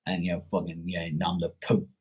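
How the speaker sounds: low, soft mock disbelief; dad's dismissive scoff. Dad doubting his child's psychic work, low dismissive mumble. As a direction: imitate dad scoffing under the breath. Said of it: "He'd be like".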